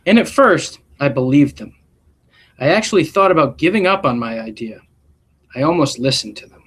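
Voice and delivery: gentle storybook read-aloud voice; expressive classroom story reading; reading to children. A narrator's reading. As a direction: read it like a picture book to a class. Reads picture book aloud.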